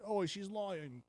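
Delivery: high, drawn-out protest; mock protest. Soldier protesting to the boss that she lies, mock indignant.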